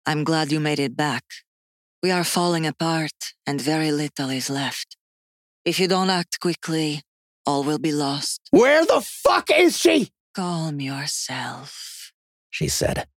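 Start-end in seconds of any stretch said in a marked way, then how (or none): 0.0-8.4 high, measured otherworldly speaker; clearer possessed voice. A narrator's reading. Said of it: "much more clearly". 8.5-10.1 high, slow, shouted question; explode, demanding an answer; furious demand. A narrator's reading. Furious demand for missing girl. 10.3-12.1 soft, very slow, high command; calm possessed voice. A narrator's reading. Possessed woman calms the shouting man.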